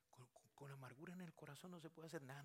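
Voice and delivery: whispered, urgent warning; hushed whisper. Real, not acted. Preacher whispering to the congregation about bitterness, hushed.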